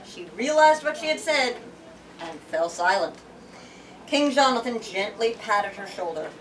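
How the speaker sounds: ordinary talk, nothing marked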